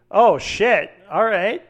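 retold startled reaction; surprised. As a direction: caught off guard and impressed. Retold reaction to her bold reply.

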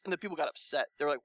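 normal talking voice

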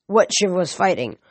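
ordinary delivery

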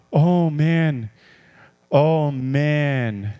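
drawn-out, sighing dismay; low, gloomy groaning words; mock despair. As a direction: moan it like someone who has given up. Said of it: "my excitement is not".

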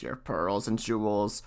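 low, slow frog voice. A narrator's reading. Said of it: "The frog answered".

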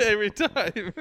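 laughing through the words. Real, not acted. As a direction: giggle it out. Laughing at the shadow.

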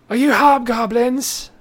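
shouting stranger. Acted. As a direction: shout up for help from a treetop. GM voices stranded merchant.

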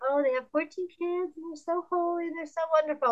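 high, gushy imitation of admiring people; gushing admirer. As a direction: mimic someone impressed in a lifted, sing-song voice. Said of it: "don't want people to look and say".

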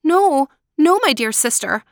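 high, urgent denial easing off; pleading innocence; protesting Marushka. Acted. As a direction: deny it anxiously, then placate. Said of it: "Marushka said".

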